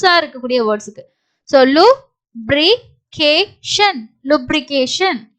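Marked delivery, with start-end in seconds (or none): 1.6-4.1 very slow, separated syllables. Shown as a demonstration. Teacher sounds out an unfamiliar word, syllable by syllable. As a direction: break "lubrication" into four pieces with gaps.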